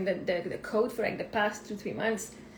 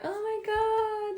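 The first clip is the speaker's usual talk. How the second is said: higher, drawn-out slow gasp of delight; gushing; 11 semitones higher, much slower than usual. Retold or imitated. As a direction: melt over how sweet it is. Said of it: "I'm like".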